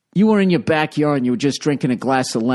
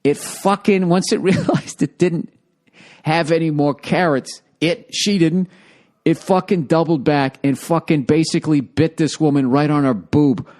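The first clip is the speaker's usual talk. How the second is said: ordinary talk, nothing marked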